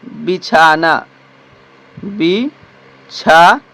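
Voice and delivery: "बिछाना" stretched very slowly twice; बहुत धीमा दोहराव; slow repeat. Shown as a demonstration. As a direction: let the class hear "बिछाना" syllable by syllable. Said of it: "क्या दोस्तों?".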